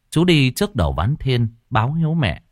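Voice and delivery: plain reading voice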